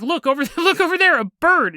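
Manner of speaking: high, loud fake alarm; mock distraction. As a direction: point away urgently. Boyfriend distracting her.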